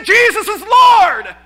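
loud, slow, high proclamation; preaching to a crowd in the street; preacher calling out. Retold or imitated. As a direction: call it out to the square.

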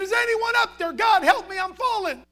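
high, loud desperate call upward; acted cry for help. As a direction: call up for rescue while hanging on. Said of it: "Kept crying out".

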